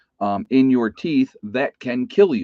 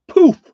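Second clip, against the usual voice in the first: a sudden high, loud "poof" like a cork flying out; mock pop of the meat flying out; loud popping poof; 11 dB louder than usual. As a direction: burst out the "poof" much higher and louder. Meat pops out of choking sister.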